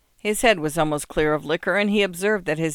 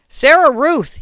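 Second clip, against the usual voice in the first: much higher, much louder shout through the door; yelled; 10 semitones higher, 10 dB louder, slower than usual. A narrator's reading. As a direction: bellow her name to be let in. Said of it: "he yelled".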